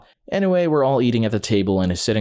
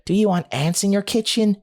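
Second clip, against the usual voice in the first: put-on four-year-old sister's retort; 9 semitones higher than usual. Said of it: "My sister, not missing a beat, replied".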